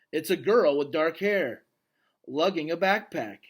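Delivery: normal talking voice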